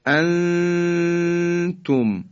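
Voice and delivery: «انتم» with the noon drawn out in a long nasal hum; very slow «انتم», long ghunna before the ت; ikhfa. Shown as a demonstration. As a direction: hold the hidden n of «انتم» on one high, level note.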